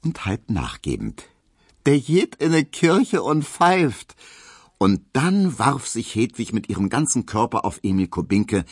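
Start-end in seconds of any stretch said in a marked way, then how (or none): 1.9-4.2 high, loud, slow Berlin teasing; half-pitying amusement. A narrator's reading. Hedwig mocks her bold little suitor, half pitying, half amused. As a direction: draw the jibe out high and loud. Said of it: "rief Hedwig halb mitleidig, halb lächelnd".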